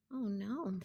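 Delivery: very soft little aside; hushed dismay. A narrator's reading. Egg finding cracks everywhere, hushed dismay. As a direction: murmur it under the story.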